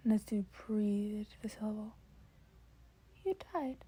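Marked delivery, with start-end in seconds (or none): 3.2-3.8 bright eager offer from a cheerful mentor; Nezu's voice. Acted. Nezu voiced by reader.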